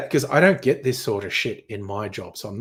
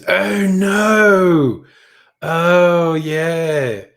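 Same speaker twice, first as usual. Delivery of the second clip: disgusted groan; 7 semitones higher, 9 dB louder, much slower than usual. Real, not acted. Recalling spilled bio waste.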